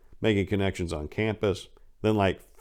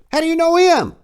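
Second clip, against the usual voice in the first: much higher and louder, alarmed question; startled pimp; 9 dB louder than usual. Retold or imitated. Pimp hearing the name Big Mo, alarmed shout. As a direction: jolt up in shock at the name.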